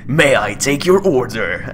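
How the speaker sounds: slow, booming trailer announcer; John. Retold or imitated. Trailer voice guy working a drive-thru window, epic announcer. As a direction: take an order like an epic movie-trailer voice. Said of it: "the guy with the epic voice".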